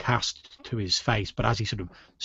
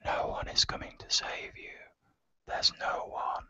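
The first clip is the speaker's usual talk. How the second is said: cold unvoiced whisper in the ear; a ghostly voice whispering at his ear; eerie whisper; 10 dB quieter than usual. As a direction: breathe it right into their ear, menacing. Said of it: "you hear this whispered voice go".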